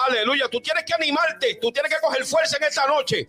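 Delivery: high impassioned preacher's voice; fervent preaching. Acted. Pastor retelling the gospel story, impassioned preaching. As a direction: keep the sermon's fire up.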